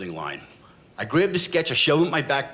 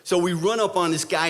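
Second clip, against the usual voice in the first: plain reading voice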